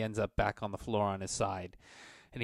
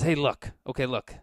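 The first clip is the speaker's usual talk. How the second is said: nervous placating; 3 semitones higher, 7 dB louder than usual. Acted. Merchant hastily placates the threatening dwarf, nervous and raised. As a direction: talk the dwarf down fast.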